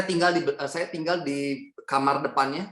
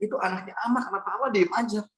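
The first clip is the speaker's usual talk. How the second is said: higher-pitched, insistent pleading; retold urgent protest; 4 semitones higher, faster than usual. Retold protest to grandmother over bleeding aunt. (memprotes nenek dengan nada mendesak)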